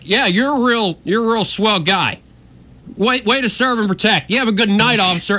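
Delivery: high-pitched retold sarcastic calling; sarcastic yelling. Storyteller re-enacting parting jabs at officer while walking away, sarcastic yelling. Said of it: "I kept yelling stuff at him".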